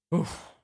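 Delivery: unvoiced puff of breath; weary huff. Torn nephew on the night road, a frustrated huff. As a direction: blow out the air, torn and tired. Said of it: "sbuffò a questo punto il signor Martino".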